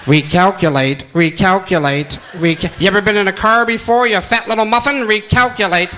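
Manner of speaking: low, flat machine voice barking recalculate; robotic GPS voice. Retold or imitated. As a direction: play the nagging sat-nav, deep and mechanical. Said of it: "the G P S has been yelling at me".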